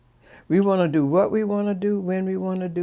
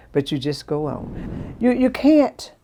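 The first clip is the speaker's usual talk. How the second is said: normal talking voice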